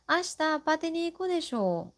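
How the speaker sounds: high, fast, desho lifted at the end; rising question tune. Shown as a demonstration. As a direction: ask "Ashita pati ni iku desho?" with a rising tune on desho, checking for agreement. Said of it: "this desho is said with the rising intonation".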